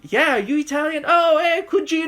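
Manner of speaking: hammed-up Italian-American greeting, high and loud; Italian-American bro. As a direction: play the over-the-top Italian cousin greeting his paisan. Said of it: "a whole bunch of Italian jokes".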